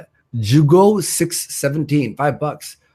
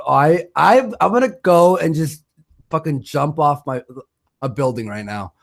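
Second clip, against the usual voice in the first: usual speaking voice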